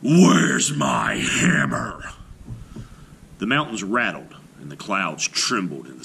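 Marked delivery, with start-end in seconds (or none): 0.0-2.1 slow, louder roar; a giant's angry roar. A narrator's reading. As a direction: bellow it out slowly. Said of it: "and roared".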